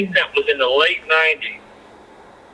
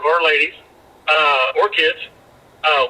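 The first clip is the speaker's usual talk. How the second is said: ordinary talk, nothing marked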